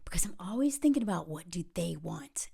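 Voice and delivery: whispering. Real, not acted. Unvoiced opening line.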